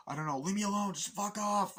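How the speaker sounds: high and loud; distraught yell. Retold or imitated. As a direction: shout them off in panic. Woman pushing them away.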